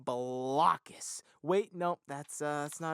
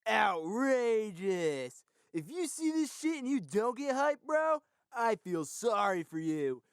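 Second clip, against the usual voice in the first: much higher, whooping hype-bro voice; hyped up; 10 semitones higher, slower than usual. Acted. Host reacting to the Game Boy ad as a hyped bro. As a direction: gush like a pumped gamer bro.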